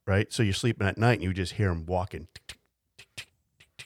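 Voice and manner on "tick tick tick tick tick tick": tapping footsteps. Retold or imitated. Imitating shoe taps.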